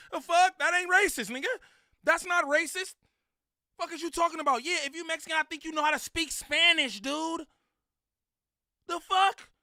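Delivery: heated rant. Real, not acted. Ranting that knowing Spanish is no racism, heated and loud. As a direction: push it up, worked up and loud.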